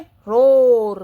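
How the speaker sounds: lion roar. Shown as a demonstration. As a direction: roll it out loud like a lion. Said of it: "Lions की sound कैसी होती है". (शेर की दहाड़ जैसी धीमी, ऊँची आवाज़)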